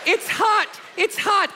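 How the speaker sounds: high squeal of mom's warning; mom's mock alarm. Mom fleeing the curling iron, high mock-alarmed yelp. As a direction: imitate a mother yelping a warning. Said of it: "And I'm like".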